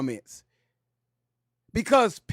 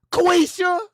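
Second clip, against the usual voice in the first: mock caller; 10 semitones higher, 6 dB louder than usual. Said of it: "caller says name".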